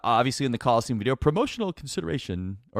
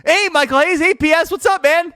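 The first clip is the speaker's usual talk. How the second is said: much higher, louder eager hello; cheery greeting; 8 dB louder than usual. Retold or imitated. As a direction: call out to him like a buddy. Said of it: "I went out of my way".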